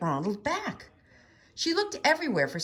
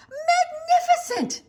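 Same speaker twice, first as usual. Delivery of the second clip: gushing praise; 8 dB louder, slower than usual. A narrator's reading. Elizabeth praising, slow, high.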